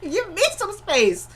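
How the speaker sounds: very high squeaky put-on whine; hammed-up falsetto complaint; mock exasperation. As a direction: squeal it in mock annoyance, breaking into a laugh.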